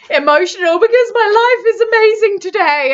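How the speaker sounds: tearful delight breaking loose; joyful outburst. Pretend. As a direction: burst out with happy emotion. Quoted happy tears peak.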